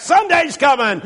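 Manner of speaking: high call back to the preacher; yelling back. Retold or imitated. Congregation answers the pastor's Friday, yelling back. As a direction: imitate a crowd yelling the answer. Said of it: "that whole congregation yelled back".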